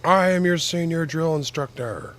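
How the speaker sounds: barked drill instructor voice; high, loud shouted drill command; Drill Sergeant Hartman. Retold or imitated. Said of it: "Sound like Drill Sergeant Hartman".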